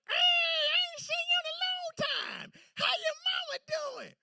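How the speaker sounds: put-on a warm old neighbor calling out in a high creaky strain; old man voice. Old Mister Paul voiced.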